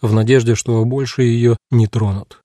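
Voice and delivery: plain reading voice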